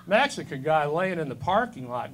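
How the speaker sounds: normal talking voice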